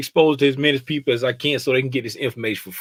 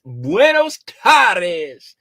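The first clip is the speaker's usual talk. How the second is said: drawn-out greeting; 3 dB louder, much slower than usual. Pretend. Spanish greeting repeated.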